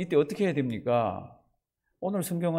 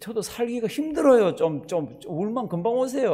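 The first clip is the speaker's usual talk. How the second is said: hiccuping plea; 6 semitones higher than usual. Retold or imitated. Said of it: "꺽꺽거리면서".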